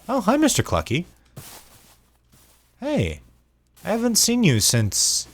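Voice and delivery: high-pitched cooing hello to a pet; playful animal greeting. Pretend. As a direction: greet the chicken like a long-lost friend. Greets a chicken as a friend.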